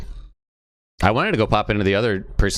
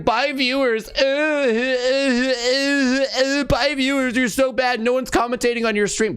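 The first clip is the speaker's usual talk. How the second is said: much higher, whiny stammering mock voice; sneering impression of the salty player; imitating the troll; slower than usual. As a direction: stutter out a pathetic taunt in his voice. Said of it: "And he was like".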